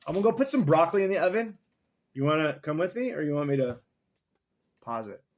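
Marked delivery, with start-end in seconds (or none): 0.0-3.5 excited aside. Real, not acted.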